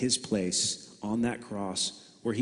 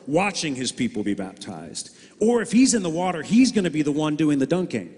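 much higher and louder impassioned preaching; fiery preaching; 7 semitones higher, 5 dB louder than usual. Acted. Preacher rousing the congregation about kings, at full force. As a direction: drive it home to the congregation.

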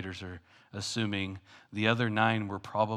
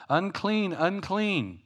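calling out; 9 semitones higher, much slower than usual. Retold or imitated. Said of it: "call out".

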